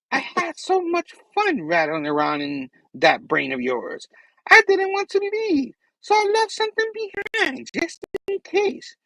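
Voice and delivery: gloating villain leaping into high squeals; Goblin. Acted. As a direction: gloat theatrically, swooping up high. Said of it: "And then back to the goblin".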